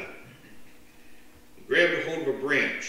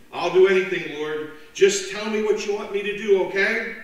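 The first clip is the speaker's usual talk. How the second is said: frantic pleading; 8 semitones higher, 9 dB louder than usual. Acted. Man begging.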